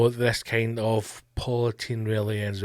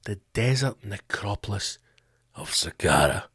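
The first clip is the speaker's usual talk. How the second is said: dramatic Hollywood trailer voice; 7 semitones lower, 6 dB quieter than usual. Shown as a demonstration. Comedian savouring the place name, in a low, soft trailer voice. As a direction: let the place name loom. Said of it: "It just sounds Hollywood".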